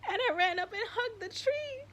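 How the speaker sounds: high, crying voice; tearful. Real, not acted. Telling viewers about hugging the tree, crying. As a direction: tell it through tears. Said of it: "makes me wanna cry".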